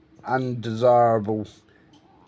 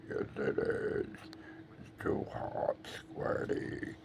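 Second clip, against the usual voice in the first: whispering; 9 semitones lower, 15 dB quieter, slower than usual. Real, not acted. Whispered baby talk to bird.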